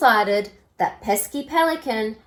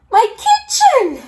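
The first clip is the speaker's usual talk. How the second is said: high, loud shriek; 9 dB louder than usual. A narrator's reading. Mum shrieks at the wrecked kitchen. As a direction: shriek it in horror. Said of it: "shrieked Mum".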